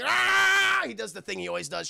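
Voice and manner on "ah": long high, loud battle scream; Wolverine yell. Retold or imitated. Wolverine unsheathing bone claws, hammed-up scream. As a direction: roar like claws are popping out. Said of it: "he does the Wolverine".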